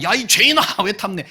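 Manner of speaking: high, loud accusation easing off; hammed-up telling-off; mock scolding shout. Neighbour berates someone for coveting, mock scolding shout.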